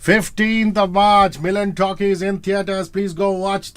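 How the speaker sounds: slow announcer plug; promo read. Acted. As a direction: announce the release slowly.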